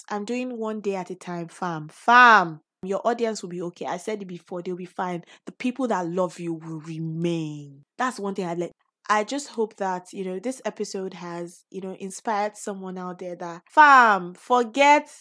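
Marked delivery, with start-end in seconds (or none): 1.9-2.6 loud call out. Real, not acted. Calling out to her audience. 13.7-15.1 loud call out. Real, not acted. Calling out to her audience.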